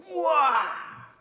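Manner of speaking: imitated child's huge, drawn-out wow; amazed child. Son amazed at the rubbish area, loud imitated wow. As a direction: cry out loud and high in wonder, stretching it long. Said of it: "이렇게 했어요, 진짜로".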